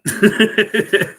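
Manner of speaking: high, loud burst of laughter. Real, not acted. Amused by the night gaunt drawing idea, laughing. Laughs at own monster joke.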